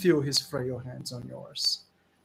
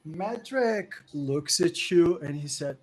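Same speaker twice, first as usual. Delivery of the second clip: ordinary delivery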